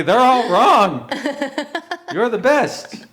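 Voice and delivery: loud, high and drawn-out; hammed-up adoring praise. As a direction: gush it loudly with mock fervour. Acting out demanded praise, hammed up.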